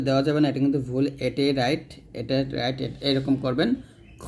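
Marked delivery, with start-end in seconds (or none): 4.2-4.3 "খ" thick and rounded, without an aa vowel; heavy kho. Shown as a demonstration. Teacher models the heavy letter, thick kho. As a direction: hold a full-mouthed "খ" as kho. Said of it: "খটা মোটা করবেন".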